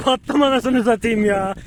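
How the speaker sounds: high, laughing groan; laughing dismay. Real, not acted. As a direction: laugh at your own mess. Fell in the mud, laughing.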